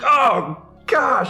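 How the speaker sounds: very high, strained wail; shaken yelp. Acted. Boy bucked off into the sand, high shaken wail. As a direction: cry it out high, as if just thrown and hurt.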